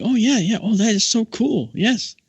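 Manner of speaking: high, loud gushing agreement; imitated eager listener. Mary Ellen eating up the space lesson, high imitated enthusiasm. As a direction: play a nun lapping up every word. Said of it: "just eating this up".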